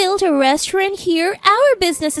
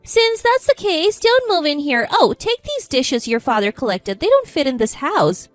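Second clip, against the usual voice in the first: higher-pitched, lightly acted dismissal; dismissive; 4 semitones higher, 4 dB louder than usual. A narrator's reading. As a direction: send them off, mildly haughty. Relatives throwing the narrator out.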